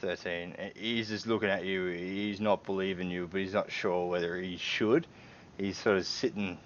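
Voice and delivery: ordinary talk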